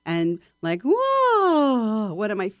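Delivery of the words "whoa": high, loud "whoa" sliding down; big vocal swoop. Shown as a demonstration. Voice teacher showing students a starting exercise, big swoop. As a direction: swoop down from a high, open-throated note. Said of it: "big swoops".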